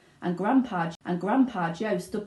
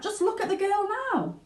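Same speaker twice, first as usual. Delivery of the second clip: Mrs Beauregard; 13 semitones higher than usual. Acted. Mother snaps at Wonka, indignant. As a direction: bite back at Wonka. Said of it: "said Missus Beauregard".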